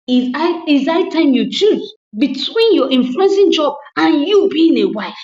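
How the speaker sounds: loud, firm demand; stern ultimatum. Retold or imitated. Husband giving his wife a final ultimatum, loud and firm. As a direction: lay down the law.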